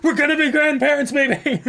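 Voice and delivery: high, loud giddy voice; thrilled grandparent. Pretend. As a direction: gush like a delighted grandparent. Voicing thrilled would-be grandparents.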